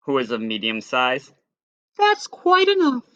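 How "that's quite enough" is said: high prim mother bear; Mama Bear scolding. A narrator's reading. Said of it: "said Mama Bear".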